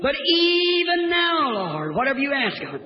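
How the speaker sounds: soaring pulpit refrain; preacher's faith cry. Acted. Same refrain.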